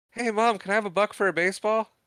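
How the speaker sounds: put-on high-pitched pleading kid; kid asking Mom. Kid to mom about a baseball, high-pitched wheedling. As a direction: pitch it up like a kid wanting money.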